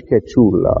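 Que chula said in Spanish; Spanish phrase. Shown as a demonstration. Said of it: "they say in Spanish".

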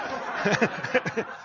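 unvoiced run of short chuckles; breathy amused chuckle; real chuckle. Real, not acted. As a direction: laugh lightly at your own story. Laughs at own story.

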